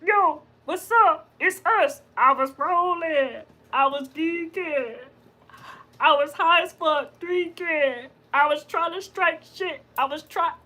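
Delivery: high, slow, stretched-out words; voice impression. Mimicking the voices in her mind, slow high impression. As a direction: draw it out in a silly high voice. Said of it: "they sound like that, the voices".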